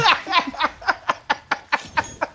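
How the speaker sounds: squealing burst of laughter; high shrieking laugh. Real, not acted. Hearing the Wolverine line, shrieking laugh. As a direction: lose it completely. Metal punchline lands.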